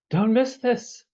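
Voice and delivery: high-pitched mock nagging plea; playful urging. Voicing message urging someone to come.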